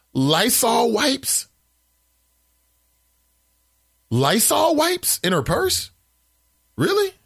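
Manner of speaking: stunned, rising questions; incredulous. Real, not acted. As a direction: repeat it like you can't believe it. Host reacts to odd comment.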